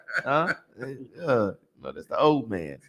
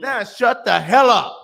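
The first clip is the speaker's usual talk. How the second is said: loud snapped command; 5 semitones higher, 7 dB louder, slower than usual. Retold or imitated. Escalating the command.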